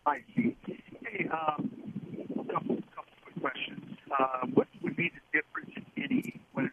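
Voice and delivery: garbled phone line; broken-up bad connection; scrambled voice. Real, not acted. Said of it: "your voice is really garbled".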